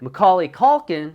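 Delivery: high, loud announcer delivery; show host intro. Acted. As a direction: open the show big and high.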